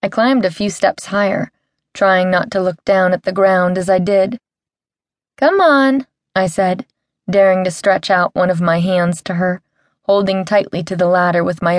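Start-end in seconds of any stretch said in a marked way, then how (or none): 5.4-6.1 coaxing. Acted. Coaxing monkey closer.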